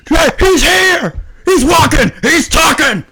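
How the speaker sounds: high excited shock; shocked shout. Real, not acted. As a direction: yell in amazed disbelief. Shocked at dragon's return.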